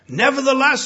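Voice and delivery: high, loud cry of nevertheless; Peter's loud, high cry. Retold or imitated. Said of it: "he says".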